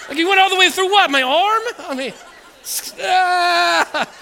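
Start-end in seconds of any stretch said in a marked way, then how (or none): 0.2-1.6 loud, high incredulous question; outraged disbelief. Retold or imitated. Patient to nurse who botched the needle, incredulous. 3.0-4.1 high, loud "ah" of pain; mock pained cry. Patient reacting to needle through arm, mock pain.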